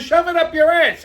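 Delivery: loud, high-pitched comeback; angry retort. Retold or imitated. Customer telling off the oil change clerk, angry retold shout. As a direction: fire it back as a retold shout.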